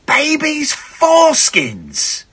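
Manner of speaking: high, slow, drawn-out disbelief; shocked exclamation. Real, not acted. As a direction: stretch it out in horror. Shocked echo of the fact.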